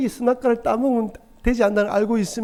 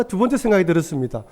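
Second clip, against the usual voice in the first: normal talking voice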